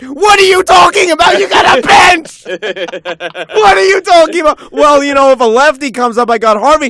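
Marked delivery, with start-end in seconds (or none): 0.0-2.4 higher and louder, disbelieving shout; angry shout. Real, not acted. Shouting at the manager's choices. 2.5-4.7 slow, drawn-out wail of disbelief; anguished wail. Real, not acted. Crying-out rant repeat.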